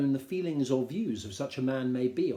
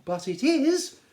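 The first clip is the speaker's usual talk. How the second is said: much higher, louder, slow and drawn out; shrill-voiced Mrs Bennet; 13 semitones higher, 8 dB louder, slower than usual. Wife calling to her husband, high and gushing. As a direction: gush it like a fussy excited wife. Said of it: "returned she".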